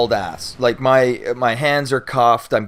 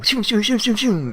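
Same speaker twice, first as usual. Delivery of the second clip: siren whoosh; 11 semitones higher than usual. Retold or imitated. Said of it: "eight cop cars go".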